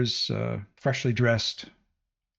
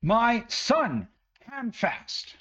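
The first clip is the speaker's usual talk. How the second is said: much higher, ranting village woman; mother berating the dwarf about her missing son, much higher and indignant; furious mother; 9 semitones higher than usual. Acted. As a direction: rage at the wizard like a worried mother. Woman questions the party.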